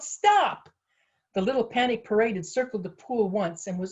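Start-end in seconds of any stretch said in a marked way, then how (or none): 0.0-0.7 high, loud shout; urgent cry, pitched way up; yelled command. A narrator's reading. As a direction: yell to halt someone running. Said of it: "Nitty yelled".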